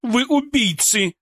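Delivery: высокий протяжный выкрик обвинения; медленно бросить обвинение высоким голосом; обвиняюще. Acted. Ольга обвиняет отряд в ссоре. (гневно, обвиняюще)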